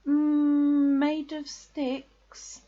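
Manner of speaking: long hum, then slow high question; uneasy realisation. A narrator's reading. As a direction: stall guiltily before asking. Dawning realisation about the house.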